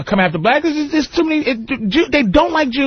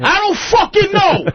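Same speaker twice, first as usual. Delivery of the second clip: higher, hammed-up irritated reply; mock exasperated husband; 9 semitones higher than usual. Husband answering jealous wife, higher mock exasperation. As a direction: bark it back at the nagging wife.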